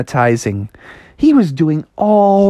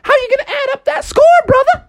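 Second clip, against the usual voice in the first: high-pitched mom voice; high, hammed-up motherly grilling; Froggy's challenging mom. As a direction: fire off questions, climbing to a fast finish.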